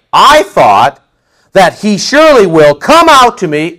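loud, offended complaint; a touch of wounded pride, loud; mad Naaman. Retold or imitated. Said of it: "Naaman is gonna get so mad".

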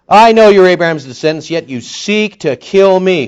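loud, high scripture proclamation; ringing pulpit quotation, slowing at the end; declaimed accusation. Acted.

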